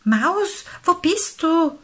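high, loud beaver call. A narrator's reading. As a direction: call for a lost friend through the rain. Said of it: "rief der Biber durch den Regen".

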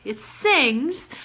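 normal talking voice

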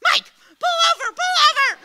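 high, loud frantic urging; frantic mom. Retold or imitated. Mom in the truck cab yelling at her husband, frantic. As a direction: yell at the driver to stop right now.

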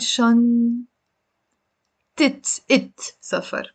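شنطة slowly broken into shan-tit; drawn-out shantit, short i before T; kasra before the T. Shown as a demonstration. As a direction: isolate the "it" ending, then سفر. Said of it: "بنقول".

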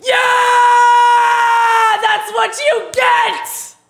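loud gloating yell, slow on the last words; triumphant shout. Real, not acted. Streamer gloats at chess opponent who flagged, triumphant shout. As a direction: crow over the opponent's blunder.

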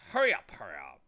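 loud, urgent hurrying; impatient bee. A narrator's reading. Bee hopping for a word from Milo, impatient and loud. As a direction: hustle them along impatiently. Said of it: "jumped up and down impatiently".

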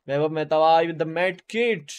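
loud, drawn-out hype call; hype shout-out. Real, not acted. Streamer hailing a viewer in live chat, hyped shout-out. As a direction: stretch it out loud like an announcer.

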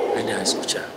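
breathy whispered monk. Retold or imitated. Monk whispering to the frantic father. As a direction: whisper it so he can't hear. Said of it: "no escuchó".

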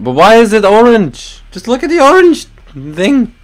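baffled squeal at an odd colour; surprised. Real, not acted. Gamer spotting an orange gun skin, surprised high squeal. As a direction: pitch jumps high, incredulous at the orange gun.

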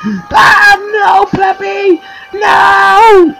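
high, drawn-out wail of "no"; hammed-up despair. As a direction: overplay the loss of a teammate, shouting. Mourns lost teammate loudly.

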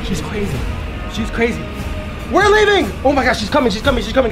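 ordinary delivery

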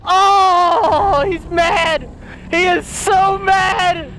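high, drawn-out shouting; excited alarm. Real, not acted. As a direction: cry out as the monster rages. Boss monster reacts to being shot.

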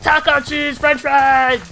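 slow, loud goofy voice; silly cartoon shout. Gamer blurting nonsense in a loud goofy voice. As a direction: bellow it like a cartoon oddball.

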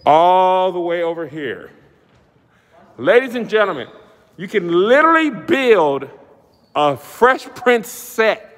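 high-pitched, slow, stretched-out announcer; theatrical ringmaster excitement; excited showman hype. Acted. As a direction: sell the huge space, drawing out each big word. Hypes the huge stage to viewers.

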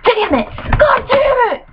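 high-pitched squeal of frustration; frustrated outburst. Real, not acted. Player cursing at the game, high-pitched frustrated outburst.

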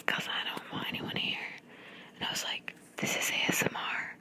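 soft, breath-only ASMR whisper; re-enacted whisper. Explaining ASMR to the guy on FaceTime, whispered. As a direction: whisper it so nobody else hears. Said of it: "why are you whispering".

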